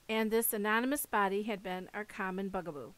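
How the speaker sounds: ordinary delivery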